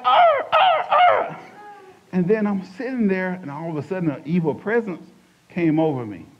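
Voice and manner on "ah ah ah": loud, very high squawking caw; raven caw. Retold or imitated. Raven heckling the pastor, loud high caw. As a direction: screech it out like a crow on a branch. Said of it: "I hear this loud noise, uh, a raven".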